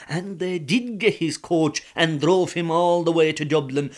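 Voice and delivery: plain reading voice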